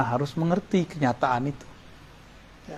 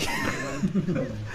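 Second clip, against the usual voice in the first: chuckle; 2 semitones lower, 10 dB quieter than usual. Real, not acted. Teacher joking about checking the book, soft chuckle. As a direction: let out a quiet chuckle. Laughs at own remark.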